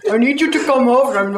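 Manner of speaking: usual speaking voice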